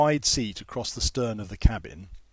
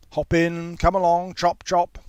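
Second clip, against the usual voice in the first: louder, higher brusque prefect calling; brisk command; 7 semitones higher, 7 dB louder than usual. A narrator's reading. Prefect hurrying the young man onto the minibus, loud and brisk. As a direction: hurry them aboard like a traffic marshal. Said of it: "like a traffic marshal".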